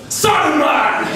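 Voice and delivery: put-on high, loud little boy shout; toddler shouting Saruman. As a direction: yell it like a proud toddler. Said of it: "this kid".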